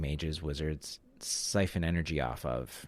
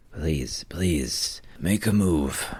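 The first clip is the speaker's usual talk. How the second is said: creepy entity urging a move; much slower than usual. Acted. GM voices the entity.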